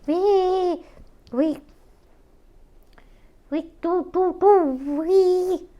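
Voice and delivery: high, loud chicken-like stammering; constipated chicken. Retold or imitated. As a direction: cluck it out like a strained chicken. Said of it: "like some sort of constipated chicken".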